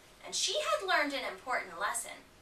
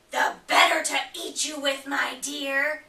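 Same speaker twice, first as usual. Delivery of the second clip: louder, back down from the high act; menacing wolf; 4 semitones higher, 9 dB louder than usual. Acted. Wolf revealing himself to Red, louder and menacing. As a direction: let the real wolf out before the pounce.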